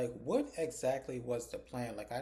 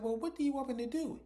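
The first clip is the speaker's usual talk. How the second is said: exasperated disbelief; 13 semitones higher, 4 dB louder than usual. Retold or imitated. Alan pleads in retold scene.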